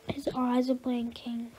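hushed, scared whisper; a frightened child whispering about the dog; fearful whisper. Acted. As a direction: whisper as if the dog might hear.

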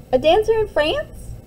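high, lively guess, bouncing up; eager child. Acted. A twin playfully tests the string's reach. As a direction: toss out the guess with delight.

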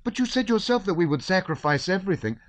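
put-on high, shrill boy retorting; defiant boy. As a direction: throw his words back at him. Boy arguing back.

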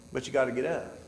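normal talking voice

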